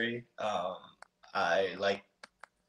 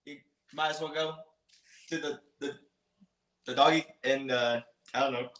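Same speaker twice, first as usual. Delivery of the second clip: a lightly put-on, hammy Australian accent, pitched higher; bad Aussie accent; 5 semitones higher than usual. Asked by the host for his best Aussie accent, a bad Australian accent. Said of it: "give me your best Aussie accent".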